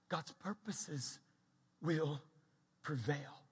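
slow, soft, deliberate declaration; solemn declaration. Real, not acted. Preacher declaring faith to the congregation, solemn. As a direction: set each word down with a long pause.

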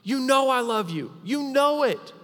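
high, strained, loud plea; exasperated pleading. Acted. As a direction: press the point, voice climbing high. Peter's imagined frustrated plea.

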